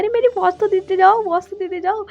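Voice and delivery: high-pitched mock yell; imitated calling out. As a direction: call after him, acting out her demand. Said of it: "फिर जिंगसू फिर चिल्लाने लगती है". (ऊँची आवाज़ में पुकारने की नकल)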